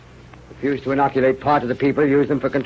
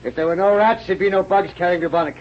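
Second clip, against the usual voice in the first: boastful bluster; 7 semitones higher than usual. Acted. Rat killer boasts of his crusade.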